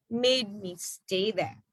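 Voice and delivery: plain reading voice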